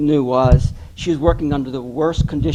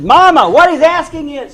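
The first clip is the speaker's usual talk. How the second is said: loud, high daughter relaying a question; retold yelling; 12 semitones higher, 9 dB louder than usual. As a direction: holler it to your deaf mother. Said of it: "we yelling at each other".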